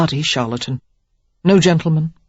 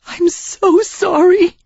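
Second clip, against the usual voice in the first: high, slow, tearful gasp; Sarah chokes out an apology; gasping through tears; 4 dB louder, slower than usual. A narrator's reading. Sarah weeping to Derek, gasping apology. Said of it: "she gasped".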